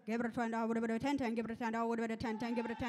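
singsong auctioneer bid chant. Acted. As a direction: roll the numbers in an auction chant. Contestant calls auction bids.

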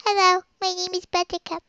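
put-on high, cutesy baby voice; little baby. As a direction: play a whiny little baby begging for food. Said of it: "And I'm a little baby".

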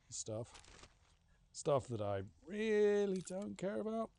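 ordinary talk, nothing marked

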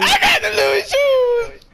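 very high, loud drawn-out yell; hammed-up high shouting imitation; mimicking a loud boast. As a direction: imitate the clip's over-the-top squeal.

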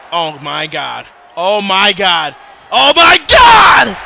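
from a near-silent gasp to a slow shout; building disbelief. Real, not acted. Commentator sees Zuko go down, hushed to shouting. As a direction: start barely audible, end shouting it slowly.

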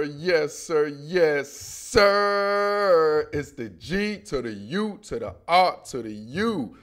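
drawn-out, low-pitched hype intro; slow, stretched-out show opener; show-intro catchphrase. Acted. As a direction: chant the greeting, stretching the last sir.

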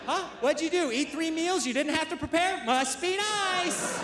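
put-on sarcastic wife, stretching the final dig; spiteful wife. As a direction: needle him in a high, spiteful wife voice. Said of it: "just spite visit me".

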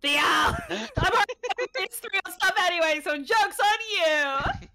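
high, loud comic outcry; mock wailing protest. Complaining to Theo about minus points, mock wail. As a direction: shout it in mock despair, stretching the end.

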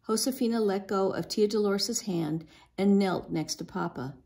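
ordinary delivery

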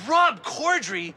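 slow, loud gasp of recognition; gasp, then draw the name out high; starstruck. Acted. Fan reading the star's name on a chair, starstruck.

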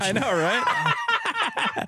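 high, squealing laugh. Real, not acted. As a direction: laugh it out giddy. Laughing in wig.

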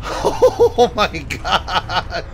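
slow, drawn-out, laughing through the words; laughing shock. Real, not acted. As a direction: let the laugh carry it. Laughing after the scare.

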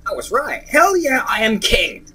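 loud, high victory shout; crowing with delight; triumphant cheer. Real, not acted. Triumph at solving puzzle.

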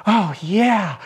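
high drawn-out mock moan; romantic moan. Shown as a demonstration. Coach pushing the romantic oh yeah further, mock moan. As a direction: sigh it out like a lover, tongue in cheek. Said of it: "I could get even more romantic".

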